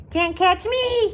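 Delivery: put-on high, loud taunting toddler; hollering. Toddler running off and taunting, high and loud. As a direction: tease the chasers at full voice. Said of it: "he hollered".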